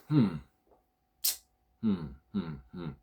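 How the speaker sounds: a quiet musing hmm before deciding; thinking hum. Real, not acted. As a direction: hum to yourself as you think it over. Pondering which creators to name.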